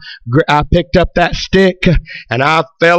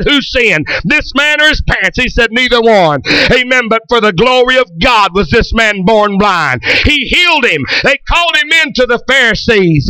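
raised, driving pulpit voice; revival preaching; 4 semitones higher than usual. Acted. Preacher urging the congregation to be ablaze, fervent. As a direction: preach it up toward the rafters.

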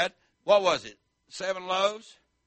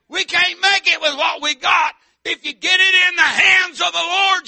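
loud, higher shouted preaching; full-force preaching; 10 semitones higher, 15 dB louder than usual. Acted. Preacher shouting faith to the congregation, loud and high.

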